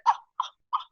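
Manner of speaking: short, unvoiced gasping "ah" sounds; staccato imitation gasps; imitated gasps. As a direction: mimic a string of shocked gasps. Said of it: "literally just that sound all around".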